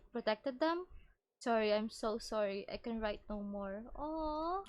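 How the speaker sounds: ordinary talk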